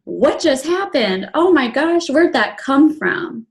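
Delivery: high, teary half-laugh of disbelief; shocked disbelief. Retold or imitated. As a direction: gasp it out, stunned and laughing through tears. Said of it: "my first thought was".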